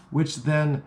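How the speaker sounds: normal talking voice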